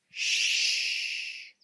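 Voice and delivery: whispered shh; shushing. A narrator's reading. Friends hiding in the dark, hushing each other. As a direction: hush everyone. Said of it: "say Mister Wolf's friends".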